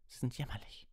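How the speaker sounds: high cry of contempt; called-out insult. A narrator's reading. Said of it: "rief Harry".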